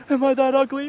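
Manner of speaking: high, loud crying plea; a hammed-up tearful question; mock crying plea. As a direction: wail it like a hurt girl. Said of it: "crying, asking".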